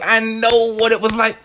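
ordinary delivery

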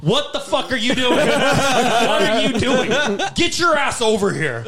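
a boss scolding who can't keep a straight face; mock scolding boss. Manager scolding, retold laughing.

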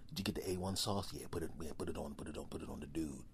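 low, fast whisper; secret thoughts. Acted. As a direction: whisper a sneaky plan. Animals planning to eat owner.

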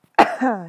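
short, loud hacking cough; coughing fit. Real, not acted. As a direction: cough hard into the mic. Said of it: "I gotta cough".

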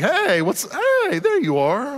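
high, drawn-out hello as a pretend newborn; cheery mock greeting. Preacher plays a newborn greeting the world, cheery mock greeting. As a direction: greet them with hammed-up cheer, pitch leaping up. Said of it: "smiling and laughing".

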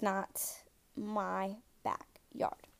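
slow, hushed whisper. Pretend. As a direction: whisper it slowly like a secret. Playful spooky denial, whispered.

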